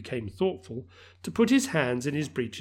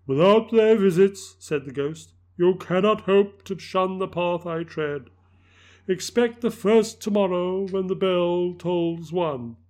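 solemn ghost voice; 5 semitones higher, 6 dB louder than usual. Acted. Ghost pronounces doom.

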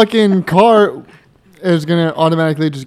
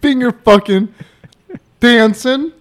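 "Finger fucking", long stop, then higher "dancing"; incredulous echo, "dancing" set apart and raised; mocking repeat with pauses; 5 semitones higher, slower than usual. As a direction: hang a big pause before "dancing". Repeats Mom's halting phrase back.

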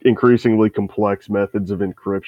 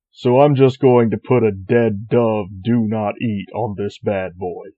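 normal talking voice

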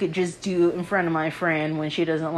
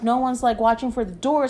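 ordinary talk, nothing marked

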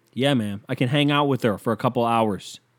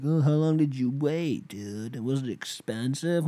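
mocking nosy people; 2 semitones higher, 4 dB quieter, slower than usual. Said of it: "they're like".

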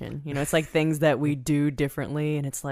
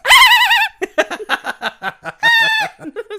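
loud laugh; 10 dB louder than usual. Real, not acted. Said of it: "I did that right into the mic".

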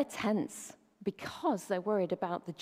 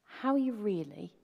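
sincere. Shown as a demonstration. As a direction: ask it as if you truly want to know. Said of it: "you sit down with a cup of coffee".

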